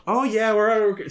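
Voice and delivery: put-on high, drawn-out childlike cheer; slow, high-pitched mock-kid glee; little kid. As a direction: imitate an excited kid on a car ride. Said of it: "she's like a little kid in the car".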